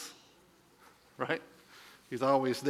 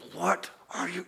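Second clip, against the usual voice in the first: whisper yell; 2 semitones higher, 7 dB quieter than usual. Retold or imitated. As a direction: yell it under your breath. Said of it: "He does a whisper yell".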